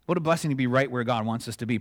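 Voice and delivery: normal talking voice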